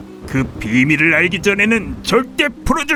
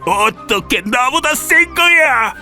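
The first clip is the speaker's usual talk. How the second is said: shocked villain; slower than usual. Acted. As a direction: draw it out in stunned disbelief. Villain Bao beaten, shocked.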